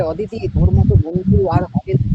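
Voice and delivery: high, sugary flattering friend; butter her up. Retold or imitated. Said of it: "বেরিয়ে প্রশংসা করতে পারে".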